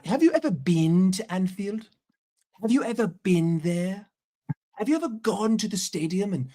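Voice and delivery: indignant mock-English questioning; imitating an English fan's challenge; mock English accent. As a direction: lean into a hammed-up English accent. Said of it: "That's what they're gonna tell you".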